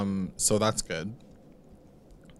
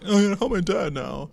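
higher-pitched mock earnestness; mocking imitation; 7 semitones higher than usual. Mimicking Archie vowing to help his dad, mocking. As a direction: put on a silly high voice to mock him.

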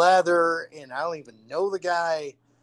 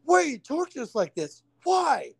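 high, pleading whine; whiny victim; 9 semitones higher than usual. Retold or imitated. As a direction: whine it like a tortured wimp. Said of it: "being the guy of".

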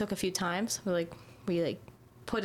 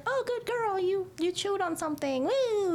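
much higher, sing-song praise for a dog; mock baby-talk praise; 12 semitones higher, slower than usual. Owner mocking overly sweet praise to the dog. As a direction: gush like praising a puppy. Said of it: "we can't be like".

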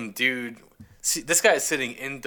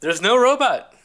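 high excited exclamation; 11 semitones higher, 7 dB louder than usual. Real, not acted. Exasperated answer to a question.

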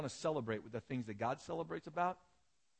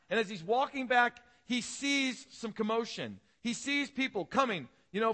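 higher and louder, building the scene for the congregation; rising sermon storytelling; 8 semitones higher, 6 dB louder than usual. Acted. Pastor telling the parable to his church, building intensity. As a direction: paint the picture with a preacher's lift.

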